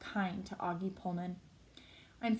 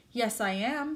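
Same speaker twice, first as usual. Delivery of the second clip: higher, louder mock-tearful insistence; sobbing; 4 semitones higher, 5 dB louder, slower than usual. A narrator's reading. Olivia insists she is awful, mildly sobbing. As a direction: insist as if sobbing, lightly acted. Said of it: "she sobs".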